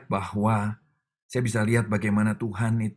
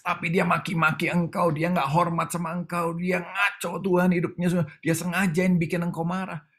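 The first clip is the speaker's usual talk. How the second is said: tattle on him like an indignant angel; protesting angel; 7 semitones higher than usual. Retold or imitated. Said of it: "malaikat bilang protes". (malaikat yang protes dengan nada tinggi)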